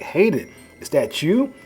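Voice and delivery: shocked. Acted. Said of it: "And he's shocked".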